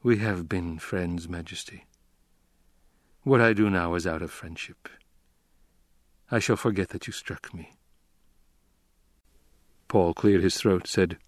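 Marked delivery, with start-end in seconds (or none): 0.0-7.7 low, subdued and unemphatic; deliberate lack of emphasis. A narrator's reading. Struck Count to his Emperor, deliberately unemphatic. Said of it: "spoke with deliberate lack of emphasis".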